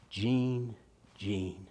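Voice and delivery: tender. Pretend. Lord gently calling a listener.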